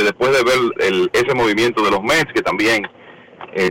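usual speaking voice

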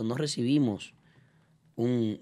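ordinary talk

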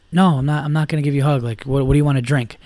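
ordinary delivery

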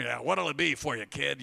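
lightly voiced gruff movie waiter; a hint of an old-film counterman; mock diner voice, not looking up; movie diner clerk. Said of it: "like something out of a movie".

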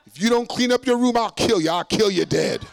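loud, high mock maternal threat; threatening mother. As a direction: imitate a Brooklyn mom laying down the law. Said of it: "My mother would say".

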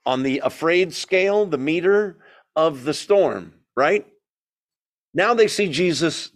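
ordinary talk

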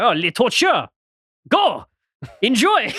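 high, exclaimed mock French accent; mock French glee. Host plays French government waving deportee off, gleeful French accent. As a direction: send them off in a gleeful put-on French voice. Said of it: "so the French government".

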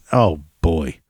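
ordinary delivery

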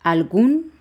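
"Algún" stressed on GÚN, loud and rising; stress on last syllable. Shown as a demonstration. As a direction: punch the ú of "Algún". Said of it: "la U lleva acento".

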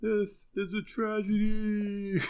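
high, slow, sing-song mock lament; John Piper. Mocking the preacher's reply to the woman, high and drawn-out. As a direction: draw it out high, almost singing. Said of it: "That's just my seashells thing over and over".

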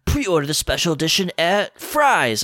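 a hint of a commercial announcer. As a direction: parody a cheesy store ad. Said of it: "I saw an ad that was like".

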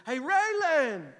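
put-on loud, high, drawn-out call to a child. Dad calling to his ignoring seven-year-old daughter, calling out. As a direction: holler the name to get their attention.

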